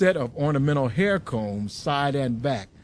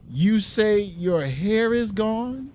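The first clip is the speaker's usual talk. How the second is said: slow, higher, dumbfounded question; stunned husband, slowly; dazed, almost idiotic; 7 semitones higher, slower than usual. A narrator's reading. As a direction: sound like the words won't sink in. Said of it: "sounding almost like an idiot".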